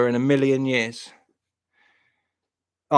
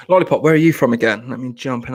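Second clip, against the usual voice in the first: normal talking voice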